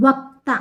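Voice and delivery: వక్త with క్ closed before the త; క first, then త. Shown as a demonstration. Teacher shows pupils the order, వక్త with క్ before త. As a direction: sound the క్ fully in వక్త, then release త. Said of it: "ఫస్ట్ కా పలకాలి".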